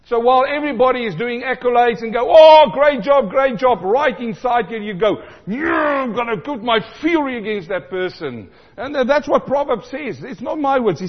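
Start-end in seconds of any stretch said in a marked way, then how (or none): 2.3-3.8 high, loud, slow fake cheer of "great job"; mock cheering. Mocking public accolades. 5.5-8.5 mock fury. Jealous inner voice plotting.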